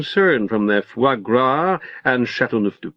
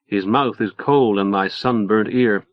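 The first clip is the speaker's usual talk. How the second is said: ordinary delivery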